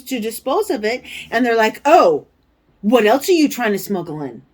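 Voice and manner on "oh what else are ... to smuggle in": loud, mocking customs officer voice; suspicious customs officer. Mimicking the customs officer accusing her of smuggling, loud and mocking. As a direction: sneer it like a suspicious border guard.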